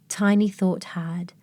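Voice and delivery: ordinary delivery, nothing marked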